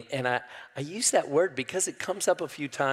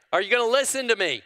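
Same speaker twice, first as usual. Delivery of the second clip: fast, loud, much higher challenge; prophet calling out; 9 semitones higher, 4 dB louder, much faster than usual. Acted. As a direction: hurl the question at the crowd. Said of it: "Isaiah calls out to them".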